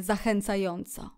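plain reading voice

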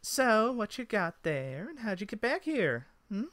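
high-pitched elf voice; high, prying elf; inquisitive elf. Acted. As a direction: question them in a high elf voice.

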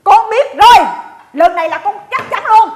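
loud, high burst of certainty; excited, emphatic declaration; triumphant announcement. Acted. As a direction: blurt it out like a sudden discovery. Announces a new diagnosis.

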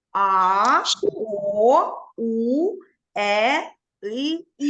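each vowel said out loud and apart; vowels listed slowly. Shown as a demonstration. Teacher to children, listing vowels, each one said apart and clear. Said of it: "їх скільки в нас?".

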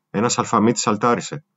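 plain reading voice

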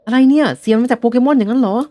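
what, a Pokémon was singing?; astonished. Acted. Trainer realising the singer is a Pokémon, astonished.

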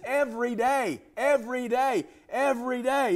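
"Every day" hammered three times, each one falling; emphasized repetition. Shown as a demonstration. Preacher stressing every day to the congregation. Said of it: "but I'm emphasizing it".

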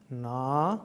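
Na with a long, held vowel. Shown as a demonstration. Teacher showing the long syllable, Na held long. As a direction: draw out the A in Na. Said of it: "And then long".

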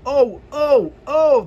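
high, loud wailing oh; wailing cars. Acted. Offended freight cars wailing at James, high and loud.

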